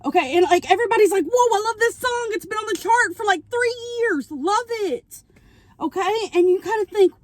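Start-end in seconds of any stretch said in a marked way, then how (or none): 1.2-5.2 mock excited fan. Townsfolk gush over the song.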